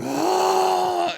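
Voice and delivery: loud high fake wail; mock bawling wail. Mom bawls at border guard, loud high wail. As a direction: wail like a sobbing pregnant woman. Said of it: "she just starts bawling".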